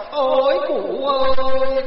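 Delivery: drawn-out, high, loud wail of grief; slow, high, loud mock lament; mock funeral wailing. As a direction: wail it like a hired mourner. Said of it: "thuê chống kèn khóc là".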